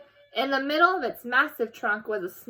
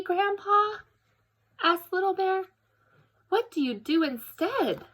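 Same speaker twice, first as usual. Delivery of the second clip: put-on high, curious child asking; little cub voice; 10 semitones higher than usual. Little bear questions grandpa, high and wondering. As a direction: lift the voice like a young cub.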